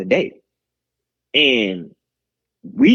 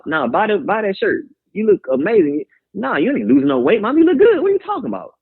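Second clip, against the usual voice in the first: hyping up mama; 6 semitones higher than usual. Retold or imitated. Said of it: "I'm still encouraging her".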